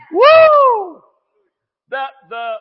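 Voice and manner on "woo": high loud joyful woo; excited whoop. Real, not acted. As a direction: cheer it out full.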